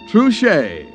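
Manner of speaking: ordinary delivery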